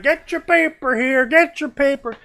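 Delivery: high, hammed-up newspaper hawker; high mock paperboy cry; hawking vendor. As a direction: call it out like a street vendor. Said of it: "He's like".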